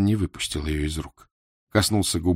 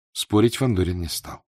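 usual speaking voice

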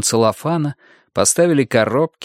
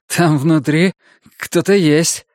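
higher, rattled answer; shaken report; 5 semitones higher, 3 dB louder, slower than usual. A narrator's reading. Gunnar telling Roger what he found inside, shaken. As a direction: blurt it out, rattled and pitched up.

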